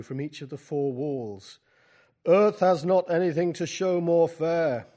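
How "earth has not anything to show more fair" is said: recited verse. Acted. Wordsworth line in a poetry reading.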